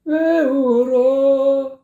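high, slow imitation of the bad phrasing; mock wrong take. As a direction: stretch 왜 울어 as the singer did. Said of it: "이렇게 하고 있다고".

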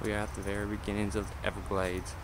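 mock southern tour-guide accent, slow; vague southern accent. Tour guide in the Everglades, mild put-on southern accent. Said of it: "I don't even know what accent I'm doing".

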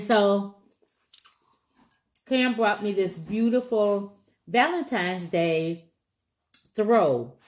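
chewing between words; talking while eating corn; mouth partly full. Real, not acted. Seen 5: Bites corn at -1.0; pursed, closed lips between words until 5.4.

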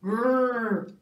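loud growl. Acted. Narrator growling like a real tiger on the hunt.

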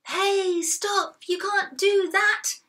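high, loud, slow scolding; storybook angry man yelling. A narrator's reading. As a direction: yell at intruders to stop. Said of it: "yelled a purple-faced angry man".

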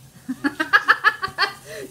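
bursting out laughing, high and loud; a big real laugh at her husband's joke. Real, not acted. Wife laughing loudly at husband's teasing remark.

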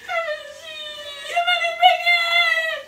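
very high, loud, long wail smearing the words; mock crying squeal. Daughter imitates mom telling grandma, unintelligible wail. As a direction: squeal it through exaggerated sobs.

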